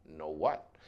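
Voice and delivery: low, soft mumble; small, low-pitched murmur; mumbled reply. Acted. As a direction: answer meekly under your breath. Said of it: "I mumbled".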